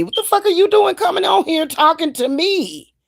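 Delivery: how dare you, yelled high; outraged outburst. Real, not acted. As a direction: pitch jumps high in sudden indignation. Angry rant at online critics.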